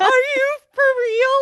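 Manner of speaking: high, loud imitation of crying; mock bawling. Friend reacting to the proposal news, mock bawling. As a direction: wail it out, imitating someone in tears. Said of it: "she just starts bawling".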